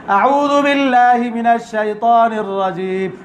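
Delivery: slow chanted recitation done without thought; careless quick recitation. Retold or imitated. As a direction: recite it by rote, like a student not paying attention. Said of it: "সাথে সাথে আমি বলবো".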